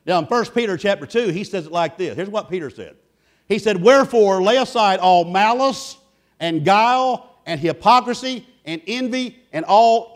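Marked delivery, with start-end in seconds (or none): 3.8-10.1 scripture reading. Acted. Reads scripture with weight.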